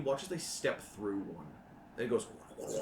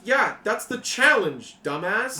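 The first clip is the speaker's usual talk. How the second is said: put-on sarcastic teen; 11 semitones higher, 13 dB louder, slower than usual. GM voices teen.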